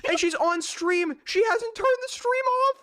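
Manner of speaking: high, slow, drawn-out disbelief; can't believe what he's seeing; amazed disbelief. Real, not acted. Disbelief at her stream.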